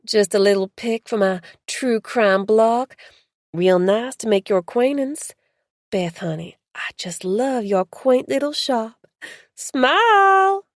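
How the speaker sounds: put-on loud, bubbly American Southern accent rising to a squeal; southern drawl. Marge introducing herself to Beth, Southern drawl. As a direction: gush in a Southern drawl, all charm. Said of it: "she said in a southern drawl".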